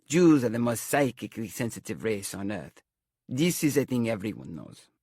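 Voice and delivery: thick, stagey Romany fortune-teller; horror-film seer's Romany accent. A narrator's reading. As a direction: declare it grandly in a Romany accent. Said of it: "She dropped the accent".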